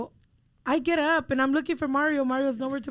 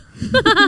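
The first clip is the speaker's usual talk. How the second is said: loud, high laugh; burst of laughter; 9 semitones higher, 11 dB louder than usual. Real, not acted. Denying she fell, teased.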